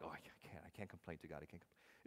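hushed, fading mock hesitation; timid believer. Imitating fearful churchgoers who dare not complain, hushed. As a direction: shrink away, voice dying out. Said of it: "Some of us are like".

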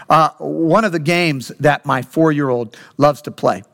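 ordinary talk, nothing marked

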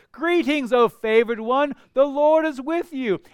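high, loud joyful announcement; a bright herald's call; excited angel greeting. Acted. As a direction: burst in with the good news. Preacher voices angel's greeting.

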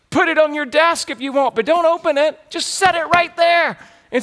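high, insistent imitation; mock-fervent urging, pitch climbing; overzealous youth pastor. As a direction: push the point like an overeager preacher.

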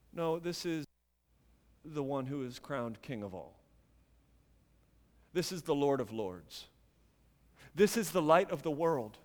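low, soft, weighty declaration; hushed solemnity. Real, not acted. Preacher tells friends who is crowned King, hushed and low. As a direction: drop right down for emphasis. Reverent correction after emphatic preaching.

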